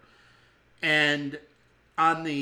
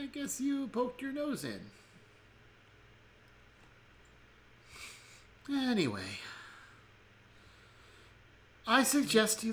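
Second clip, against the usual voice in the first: put-on old woman voice; 5 semitones higher than usual. GM plays Grimagrak the old woman.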